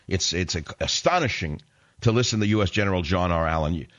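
normal talking voice

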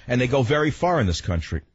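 ordinary talk, nothing marked

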